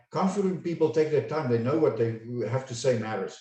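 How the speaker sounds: hurried run-through without pauses; quick, throwaway demo of poor pacing; rushed. Shown as a demonstration. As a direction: rattle it off fast as one phrase. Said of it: "That sounds way better than".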